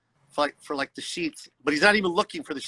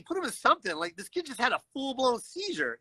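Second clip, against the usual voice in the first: exasperated protest; 6 semitones higher, 4 dB quieter than usual. Retold or imitated. Outraged that firemen walk patient out.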